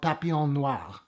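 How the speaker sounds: lightly put-on French accent. Shown as a demonstration. Reviewer showing off the film's French title, in a French accent. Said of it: "That's my French accent".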